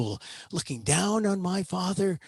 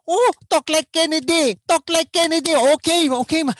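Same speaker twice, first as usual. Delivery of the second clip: put-on high, loud Filipino-accented parent; imitating delighted Filipino parents, loud and high; parents' Filipino accent; 10 semitones higher, 6 dB louder than usual. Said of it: "Uh, they'd say".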